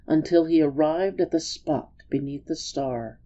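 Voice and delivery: ordinary delivery, nothing marked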